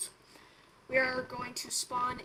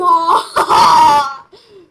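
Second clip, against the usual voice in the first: laughing; 4 semitones higher, 21 dB louder than usual. Real, not acted. Laughs at prank on friend.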